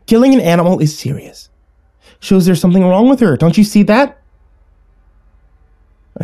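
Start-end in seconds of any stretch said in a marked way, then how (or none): 0.1-4.2 high, agitated lecture rising to a challenge; scolding mother. A narrator's reading. Mother insists to child that sister is disturbed, scolding. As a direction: scold with the pitch climbing.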